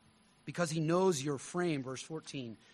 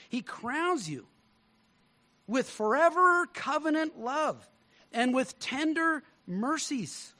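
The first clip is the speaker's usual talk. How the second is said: preaching at full force; 6 semitones higher than usual. Acted. Preaching builds.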